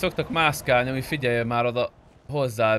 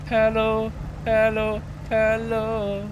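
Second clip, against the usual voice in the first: repeated high sing-song hellos, well above his usual voice; calling out hello; 8 semitones higher, much slower than usual. Real, not acted. Checking whether anyone is on the line, calling out high sing-song hellos. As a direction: call out hello over and over in a high voice.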